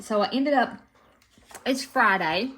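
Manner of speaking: talking around a bite of chocolate; chocolate in the mouth while talking; mouth full. Real, not acted. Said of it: "I'm gonna talk with chocolate in my mouth".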